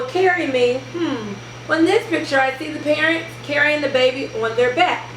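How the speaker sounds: usual speaking voice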